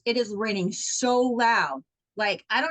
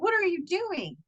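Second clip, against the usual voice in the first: higher, puzzled challenge; retold startled question to husband; surprised question; 6 semitones higher than usual. Wife surprised husband is up, higher puzzled question.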